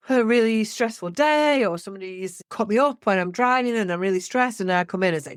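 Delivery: ordinary talk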